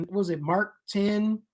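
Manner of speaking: ordinary talk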